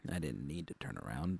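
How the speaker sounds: usual speaking voice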